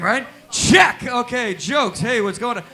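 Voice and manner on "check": a sudden high, loud bark of check; shouted check. Real, not acted. Comic shouting a mic check at the crowd, high and loud. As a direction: jolt the room.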